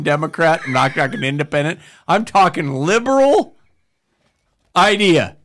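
usual speaking voice

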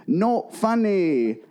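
high, very slow "Not funny"; mock [name] line. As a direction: stretch each word out for the babies. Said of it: "it popped in my head to say to them".

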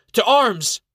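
high, loud call to arms; rally the camp with a sharp yell; alarmed yell. Acted. Dame Frances alerting the rangers to the intruder, yelling. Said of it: "she yells".

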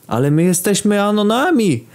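a light hint of many voices answering together; said in chorus. A narrator's reading. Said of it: "powiedzieli chórem".

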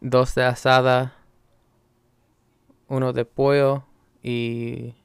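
Spanish taco order, measured and deliberate; Spanish accent. Acted. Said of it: "throw in the English and Spanish".

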